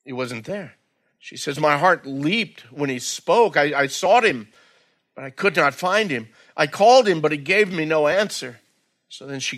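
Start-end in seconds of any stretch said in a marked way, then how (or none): 1.6-6.3 raised, earnest reading of the bride's words; dramatic Bible reading; scripture reading. Acted. Reads scripture passage dramatically. 6.6-7.2 louder, higher call; crying out for the beloved. Acted. Bride calling for beloved.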